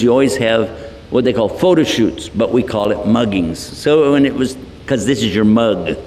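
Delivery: normal talking voice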